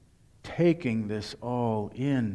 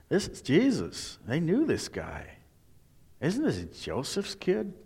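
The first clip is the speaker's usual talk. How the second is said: doubting townsfolk; 5 semitones higher than usual. Retold or imitated.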